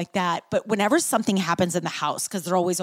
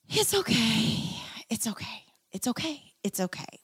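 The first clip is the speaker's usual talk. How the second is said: calm yourself, repeating it ever softer; soothing mantra; 5 dB quieter than usual. Shown as a demonstration. Showing listeners how to say the mantra, soft and soothing.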